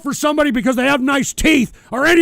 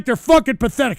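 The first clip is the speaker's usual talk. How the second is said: ordinary talk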